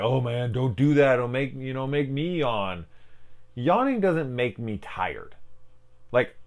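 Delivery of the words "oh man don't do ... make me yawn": higher, louder whiny objection; acted-out griping, pitched up; mock complaint. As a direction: protest like someone annoyed by a contagious yawn. Acts out people complaining about yawns.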